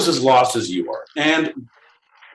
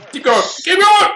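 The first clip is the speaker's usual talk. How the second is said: urging; 4 dB louder than usual. Real, not acted. Urging partner on.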